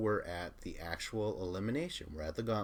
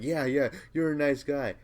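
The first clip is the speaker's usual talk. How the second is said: loud, high mock agreement; retold sneering sarcasm, pitched up; sarcastic comeback; 7 semitones higher, 8 dB louder than usual. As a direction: toss it out loudly, mocking the nice guy.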